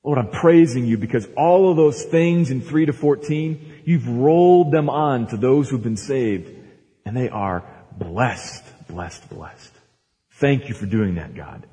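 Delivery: a prayer spoken aloud, slowing on "blessed, blessed"; lightly voiced prayer of thanks; heartfelt prayer. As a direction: pray it out in thanks. Preacher voices Paul's prayer.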